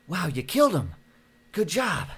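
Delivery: high, loud, overdone praise; mock impressed. Clay praises the killing.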